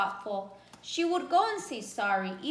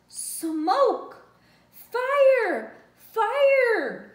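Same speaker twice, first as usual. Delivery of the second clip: much louder, higher alarm shout; shouting fire; 9 semitones higher, 9 dB louder, much slower than usual. A narrator's reading. Horse wakes the animals, shouting fire loud and high. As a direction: raise the alarm in panic. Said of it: "he neighed".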